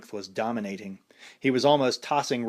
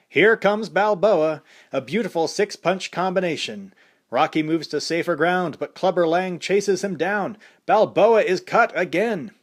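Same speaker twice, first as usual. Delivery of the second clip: excited ringside announcer, higher and louder; boxing announcer calling the action; fight commentator; 7 semitones higher, 6 dB louder than usual. A narrator's reading.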